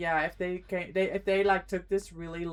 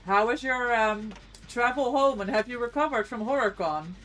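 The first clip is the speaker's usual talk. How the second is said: normal talking voice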